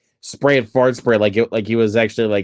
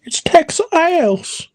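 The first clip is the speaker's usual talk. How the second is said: high, slow silly drawl; slow goofy voice; much slower than usual. Retold or imitated. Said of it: "repeats the quote".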